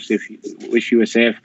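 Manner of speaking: usual speaking voice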